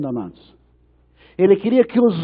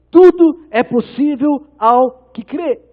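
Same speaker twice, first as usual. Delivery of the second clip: resposta de Jesus; 8 semitones higher, 7 dB louder, much slower than usual. Retold or imitated. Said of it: "Jesus chega e fala pra ele".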